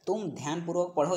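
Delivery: normal talking voice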